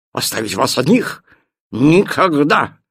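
loud indignant dog. Acted. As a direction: protest with outrage. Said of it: "возмутился пёс".

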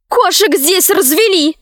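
high, petulant princess voice; capricious princess. A narrator's reading. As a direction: complain like a spoiled princess, pitched high. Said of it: "сказала принцесса капризным голосом". (капризным голосом принцессы)

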